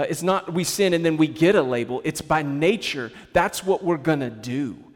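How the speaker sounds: ordinary talk, nothing marked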